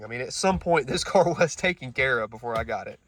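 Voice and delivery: ordinary talk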